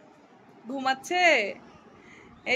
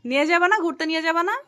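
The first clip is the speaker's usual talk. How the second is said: higher and louder, insistent questions; loud pleading nag; 6 semitones higher, 7 dB louder than usual. Real, not acted. Wife pestering sleepy husband to go out, loud nag. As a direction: keep pushing until he answers.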